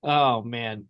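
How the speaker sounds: normal talking voice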